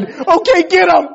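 high, loud instant agreement; eager child imitation. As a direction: jump in like an overeager kid. Imitating son's eager reply.

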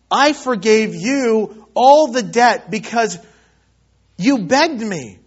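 loud, slow, emphatic rebuke; angry master. Acted. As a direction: reproach the servant, punching each phrase. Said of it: "his master was angry".

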